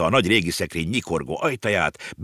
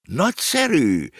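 higher, slowed, delighted cry; exclaiming delight; 9 semitones higher, slower than usual. A narrator's reading. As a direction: call out with real pleasure. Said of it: "kiáltott fel Negró Cavallo". (lelkes felkiáltás)

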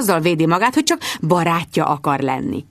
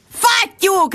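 high shrieked curse; high shrieked Fattyúk; shrieking; 12 semitones higher than usual. Acted. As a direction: shriek along with her.

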